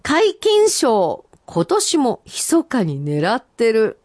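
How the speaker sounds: senryu recital. Acted. Reading senryu aloud.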